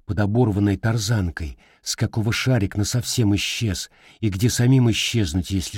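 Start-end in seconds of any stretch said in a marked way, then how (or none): none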